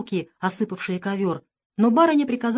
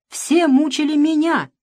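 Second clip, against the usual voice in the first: loud, high, wounded cry; anguished outburst; 7 semitones higher, 6 dB louder than usual. A narrator's reading. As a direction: throw the blame back passionately. Mother hurls accusation back.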